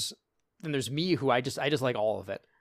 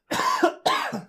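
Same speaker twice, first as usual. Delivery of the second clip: sudden choking cough; coughing fit; 6 dB louder than usual. Real, not acted. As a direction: cough after swallowing wrong. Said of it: "the gasp went down the wrong way".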